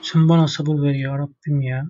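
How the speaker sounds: soft, low plea for patience; exasperated prayer. Real, not acted. Gamer begs God for patience, soft and weary. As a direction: sigh it out under your breath to God.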